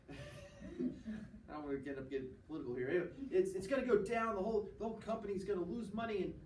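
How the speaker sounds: ordinary talk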